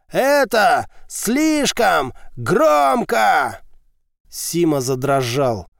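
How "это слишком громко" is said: slow, high, drawn-out roar. A narrator's reading. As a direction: bellow it out breathlessly. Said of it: "взревел".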